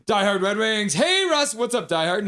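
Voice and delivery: high, loud hailing welcome; excited greeting. Real, not acted. Host welcoming a regular viewer to stream, excited. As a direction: sing out a big hello.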